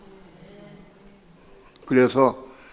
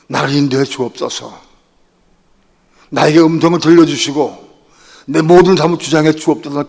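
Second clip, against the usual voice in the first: loud, raised crying-out prayer; fervent prayer; 3 semitones higher, 9 dB louder than usual. Retold or imitated. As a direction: pray out loud in earnest. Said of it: "기도했어요". (크고 간절한 기도)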